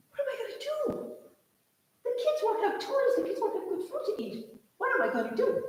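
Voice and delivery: high, fretful little engine voice; high anxious little engine asking what to do; worried little engine. Acted. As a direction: squeak out the despair of a tiny engine. Poor little engine despairs.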